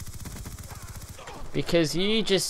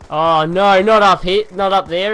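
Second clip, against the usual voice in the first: alarmed cry; 4 semitones higher, 8 dB louder than usual. Real, not acted. Spotting enemies again.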